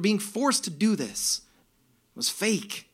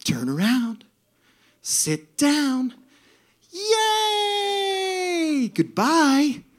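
sweet angel's singsong, lifting higher; angelic, joyful; 8 semitones higher, 3 dB louder, much slower than usual. Retold or imitated. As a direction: chant it like a delighted angel greeting riders. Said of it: "and it was joyful".

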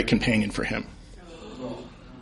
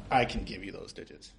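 much lower and softer sleazy voice; creepy flirt; 7 semitones lower, 15 dB quieter than usual.

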